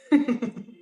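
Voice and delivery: loud high laugh; burst of laughter. Real, not acted. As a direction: break out laughing for real. Laughs at the passage.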